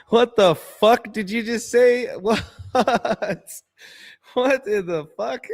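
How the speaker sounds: high, loud disbelieving yell; shocked outburst. Retold or imitated. Young father yelling at his friend's punchline, shocked. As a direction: cry out in stunned disbelief. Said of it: "I'm like".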